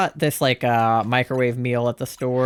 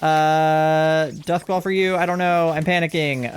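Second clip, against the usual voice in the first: usual speaking voice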